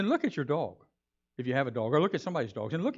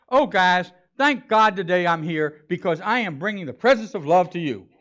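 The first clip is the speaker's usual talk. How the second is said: louder, hammed-up gushing to coworkers; mock announcement; 8 dB louder than usual. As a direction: overdo it like a preachy coworker. Mocking showy office announcement.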